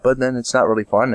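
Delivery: ordinary delivery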